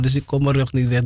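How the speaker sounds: usual speaking voice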